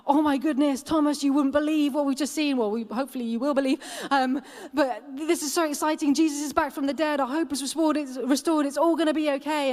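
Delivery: gushing like a thrilled disciple; hyped up. Retold or imitated. Disciple bursting with news to Thomas, excited. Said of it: "They're all like".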